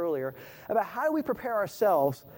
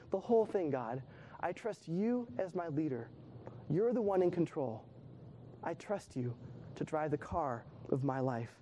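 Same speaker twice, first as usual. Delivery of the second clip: soft surrendering prayer, fading softer; quiet prayer; 4 semitones lower, 8 dB quieter than usual. Pretend. Believer prays surrender to God, soft and tender. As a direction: hand it all over to God. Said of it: "It's saying".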